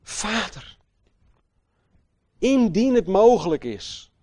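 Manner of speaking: loud, high cry to the Father; Jesus crying out in prayer, loud and high. Retold or imitated. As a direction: call out in anguish. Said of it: "hij schreeuwde het uit".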